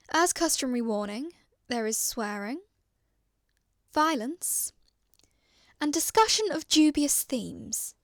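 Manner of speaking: an announcer warning the listeners before the recovered logs play; announcer read. Acted. Ominous announcer introducing the crew's recovered audio files.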